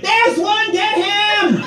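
high, hammed-up mob voice. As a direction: squeal it like a pack closing in on prey. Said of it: "they'll be like".

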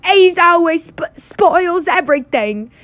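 high, loud, drawn-out bratty wail; pretend-sobbing yell. A narrator's reading. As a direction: stammer and wail with fake tears. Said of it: "yelled between huge pretend sobs".

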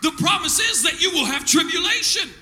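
shouted, sing-song preacher cadence; fiery preaching. Acted. Pastor preaching hard to his church, shouted and rhythmic. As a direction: preach it at full force to the congregation.

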